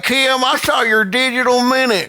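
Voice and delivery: high, hammed-up Southern twang; exaggerated Southern yokel, stretching the last words; Southern accent. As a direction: holler it in a mock Southern accent. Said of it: "now in a Southern accent".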